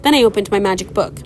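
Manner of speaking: usual speaking voice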